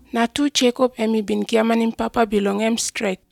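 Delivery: ordinary talk, nothing marked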